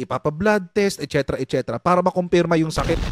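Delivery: ordinary delivery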